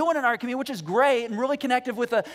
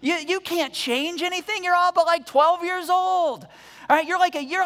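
put-on high, loud taunting of a child; mocking shout; 8 semitones higher, 5 dB louder than usual. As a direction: sneer at him like a giant mocking a kid. Goliath mocking young David.

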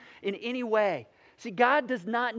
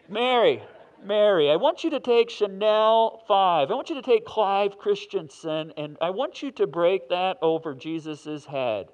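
loud, slow, booming divine command; God as a store loudspeaker, slow and loud; God over a loudspeaker; 9 dB louder, slower than usual. Retold or imitated. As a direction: announce it like God on a PA system. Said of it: "loud system like that".

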